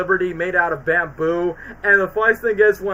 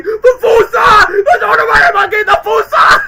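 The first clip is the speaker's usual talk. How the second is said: much higher, loud, slow screaming panic; panicked lemur screams; 10 dB louder than usual. Retold or imitated. Lemurs scream at the fossa, hammed-up panic. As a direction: shriek the warning in terror. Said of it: "the lemurs freak out by saying".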